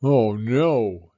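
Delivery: father's dismayed groan. A narrator's reading. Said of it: "said her father".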